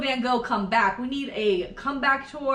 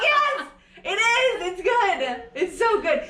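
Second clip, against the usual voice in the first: high, loud and laughing, stretched out; insistent laughing; 8 semitones higher, 5 dB louder, slower than usual. Retold or imitated. Insisting to Simon the show is good, laughing. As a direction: defend the show through laughter. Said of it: "I'm like".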